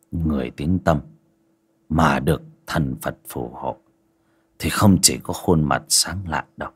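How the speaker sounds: put-on low, soft old master's voice; low calm master. A narrator's reading. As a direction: speak quietly like a grave old sage. Ông thầy cắt lời bà Báu.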